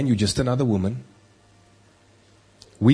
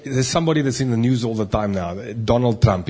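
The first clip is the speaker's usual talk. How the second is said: ordinary talk, nothing marked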